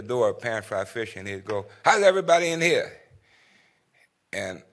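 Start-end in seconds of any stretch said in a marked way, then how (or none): 1.8-3.0 loud, higher announcing call; Ace's announcement. Retold or imitated. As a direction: boom it out as you walk in. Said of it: "Ace was announcing his presence".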